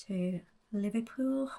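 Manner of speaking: a bad, quick Liverpool accent. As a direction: toss off a Scouse accent. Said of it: "That was a really bad Liverpool accent just there".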